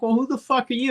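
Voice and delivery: high-pitched indignant retort; mock indignant. Woman confronts the man at her door, mock indignant.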